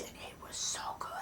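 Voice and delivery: hushed whisper of praise. Real, not acted. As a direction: whisper it like a secret.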